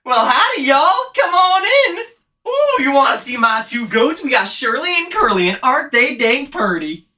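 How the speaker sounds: loud, high-pitched hillbilly holler. Acted. Hillbilly welcoming haunt guests to see the goats, hollering. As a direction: whoop it out like an upbeat Southern haunt character. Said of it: "one of the hillbilly lines".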